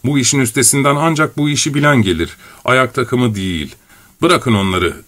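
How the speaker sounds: plain reading voice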